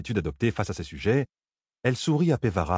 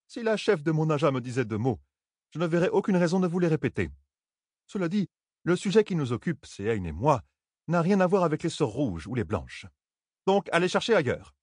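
higher-pitched, scoffing refusal; scornful retort; 7 semitones higher than usual. A narrator's reading. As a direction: send them packing with disdain. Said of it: "Pevara croisa les bras et ricana". (riposte méprisante, voix plus aiguë)

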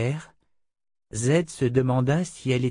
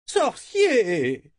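shrill, high whining cry; whiny voice; 12 semitones higher, 3 dB louder than usual. Acted. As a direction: whine it like a spoiled noblewoman. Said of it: "lança une voix géniarde".

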